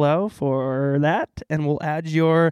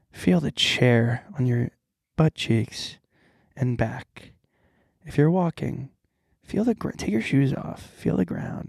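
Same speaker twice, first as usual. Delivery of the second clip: guided meditation; 3 dB quieter than usual. Pretend. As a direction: lead a playful calming exercise. Leads a mock meditation.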